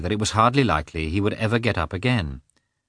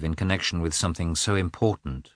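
ordinary delivery, nothing marked

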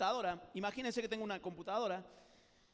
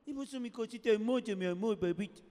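louder cooing mom voice; doting baby talk; 5 dB louder than usual. Retold or imitated. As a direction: smother him with baby words. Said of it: "te hablan con la vocecita esa".

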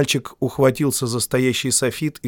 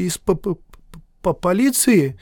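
nervous stammer; 6 semitones higher than usual. A narrator's reading. Editor stammers.

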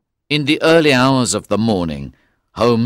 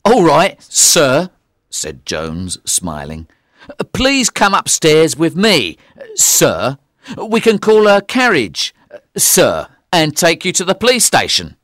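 loud, higher overdone courtesy; mock politeness; 6 semitones higher, 4 dB louder than usual. A narrator's reading. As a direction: pile on the sirs to mock him. Jones mockingly humours the prisoner.

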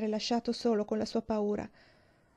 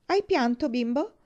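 put-on sneering child's jab, higher and louder; mocking taunt; 6 semitones higher, 6 dB louder than usual. A narrator's reading. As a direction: tease him for crying. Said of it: "lo schernì Andy". (tono di scherno)

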